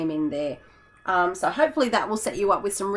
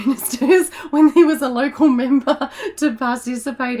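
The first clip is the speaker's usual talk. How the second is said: laughing while talking; 6 semitones higher, 8 dB louder than usual. Real, not acted. Telling viewers about the ex-prime minister, laughing through it. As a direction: laugh through the words, louder and higher.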